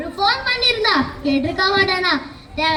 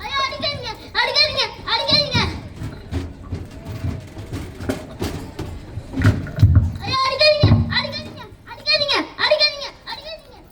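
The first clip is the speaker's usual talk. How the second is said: mock begging; 5 semitones higher, faster than usual. Beaten again, begs.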